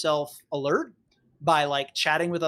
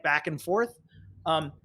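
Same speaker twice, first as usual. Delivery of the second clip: normal talking voice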